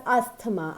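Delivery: ASTHMA as "AS-tuh-ma", th sounded; common mistake. Shown as a demonstration. Teacher quotes many people's mistake, asthma as "as-tuh-ma". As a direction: say asthma in three syllables with a t. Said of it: "So many people used to call it".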